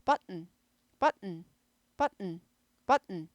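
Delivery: 버튼 with a held stop before n; 버튼 as but, a stop, then n; American button. Shown as a demonstration. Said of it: "버튼 대신에".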